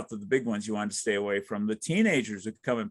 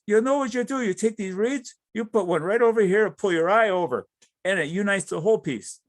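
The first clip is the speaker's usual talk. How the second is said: put-on light German accent, higher and louder; a hint of a German friend's accent, raised and loud; 9 semitones higher, 6 dB louder than usual. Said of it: "he's got a very thick German accent".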